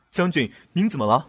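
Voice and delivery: startled, rush to ask; alarmed concern. Acted. Aide to his sick general, alarmed and loud.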